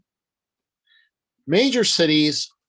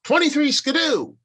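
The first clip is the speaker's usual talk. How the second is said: much higher, a hammed-up twenties catchphrase; old-timey slang quote; 13 semitones higher, 4 dB louder than usual. Writer mimics nineteen-twenties slang, high and hammed-up. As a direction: pop it out high like an old-timer showing off. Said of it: "You know, if you say".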